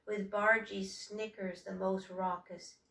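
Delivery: ordinary delivery, nothing marked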